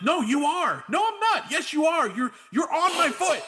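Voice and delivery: high-pitched mock argument; imitating an exasperated quarrel; acted bickering. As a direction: fire retorts back and forth, getting insistent. Retells a back-and-forth quarrel.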